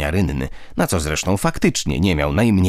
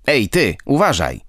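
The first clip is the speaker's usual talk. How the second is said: a sharp, higher, angry warning snapped out; angry snarl; 6 semitones higher than usual. A narrator's reading. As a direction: snarl it at the careless kid. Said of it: "warknął gniewnie Szurek". (gniewne warknięcie, wyżej i głośniej)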